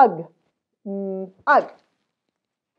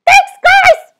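high, loud puppet voice; a tiny dog shouting thanks; squeaky puppet thanks; 20 dB louder than usual. Pretend. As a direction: cheer it out as the puppet. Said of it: "What do you say to the kids?".

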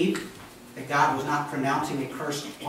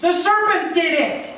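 flustered excuse; 9 dB louder than usual. Retold or imitated. The woman blames the serpent to God, flustered and high. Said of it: "And the woman goes".

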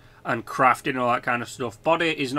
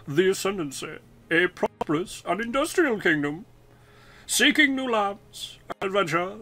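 higher, refined storyteller voice, far from his own; posh person voice; 5 semitones higher than usual. Pretend. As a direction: narrate like a posh announcer. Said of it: "let me put on my posh person, person voice".

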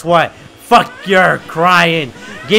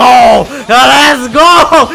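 high, loud let's go; hyped shout; 5 semitones higher, 8 dB louder, much slower than usual. Real, not acted. Player hyping himself while catching a ghost, loud cheer. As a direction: yell it like a win.

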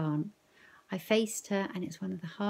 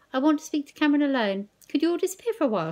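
brisk Nan; 8 semitones higher, 7 dB louder, much faster than usual. Acted. Nan sending everyone away.

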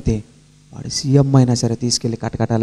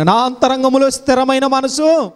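preaching at full force; 12 semitones higher, slower than usual. Acted. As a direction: build the sermon to a ringing peak. (ఉద్రేకంతో బోధించే స్వరం)

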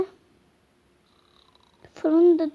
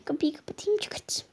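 whisper; 8 dB quieter than usual. Pretend. Quietly closing door.

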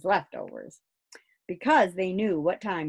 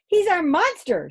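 put-on higher, louder boyish shout; 12 semitones higher, 8 dB louder than usual. Angus to Father about his monster, shouting high. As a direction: shout it proudly as a small boy. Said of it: "shouted Angus".

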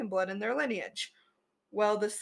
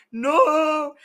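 sudden loud, higher cry of embarrassment; embarrassed no; 6 semitones higher, 10 dB louder than usual. Real, not acted. Storyteller cringing at her own druid voice, loud embarrassed cry.

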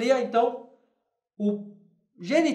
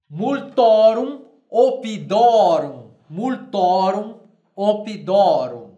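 slow, louder multorum oppidorum; slow emphatic Latin model; 6 dB louder, slower than usual. Shown as a demonstration. Teacher drilling Latin genitive for students. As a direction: drill the form for the class.

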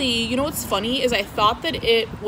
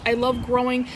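ordinary delivery

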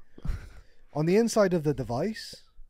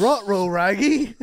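Scooby-Doo voice; 3 semitones higher, 6 dB louder than usual. Retold or imitated.

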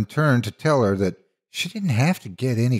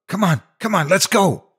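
put-on boy yelling at his entranced friend to run; urgent yell; 11 semitones higher than usual. A narrator's reading. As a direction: yell at her to run now. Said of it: "I yelled at her".

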